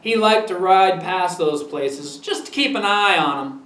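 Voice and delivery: plain reading voice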